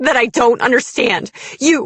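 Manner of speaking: loud, high, anguished outburst; shaken fury. A narrator's reading. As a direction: let the accusation burst out, overwrought. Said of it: "My voice shook".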